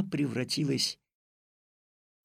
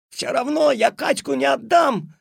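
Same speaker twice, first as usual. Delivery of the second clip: high, loud defiant cry; defiant shout; 12 semitones higher, 10 dB louder than usual. Acted. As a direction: shout back while struggling. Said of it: "закричал Алёшка".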